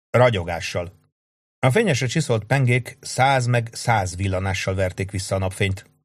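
ordinary delivery, nothing marked

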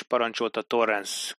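ordinary delivery, nothing marked

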